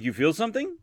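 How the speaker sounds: surprised doctor voice. Retold or imitated. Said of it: "He's like".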